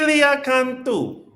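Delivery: high, slow, drawn-out name call; sing-song host call of the name; announcer call. Pretend.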